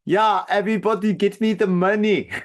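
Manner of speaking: German-accented mock robber demand. Shown as a demonstration. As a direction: put on a hammy German accent. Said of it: "would I do, like, a German accent?".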